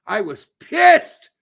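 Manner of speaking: slow and loud; angry. Real, not acted. Telling his co-host how angry the match made him, slow and loud. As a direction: lean hard on pissed. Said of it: "fucking out of my mind".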